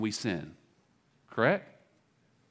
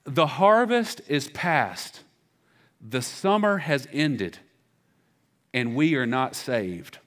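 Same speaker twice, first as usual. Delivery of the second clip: slow, solemn reading of scripture; 2 semitones higher, 5 dB louder, much slower than usual. Shown as a demonstration. Pastor reading the verse aloud to the church, slow and solemn. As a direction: read the verse slowly, letting each line land. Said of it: "Listen to verse twenty".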